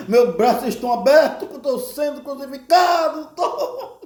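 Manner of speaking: high, hurried comic protest, laughter creeping in; Jesus indignado. Retold or imitated. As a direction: complain in mock exasperation through giggles. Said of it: "falando assim". (reclamação cômica e aguda, quase rindo)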